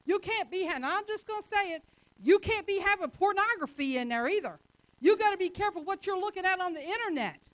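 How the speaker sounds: high, loud, building sermon warning; preaching at full force. Acted. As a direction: drive the warning home to the congregation. Preaching climax on sin.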